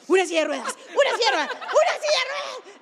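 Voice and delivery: imita gritos cada vez más agudos; grito desesperado imitado. Retold or imitated. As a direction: pide auxilio subiendo el tono en cada repetición. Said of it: "Empieza así como a gritar". (gritando desesperado, en imitación cómica)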